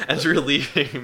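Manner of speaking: ordinary talk, nothing marked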